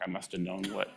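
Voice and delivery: usual speaking voice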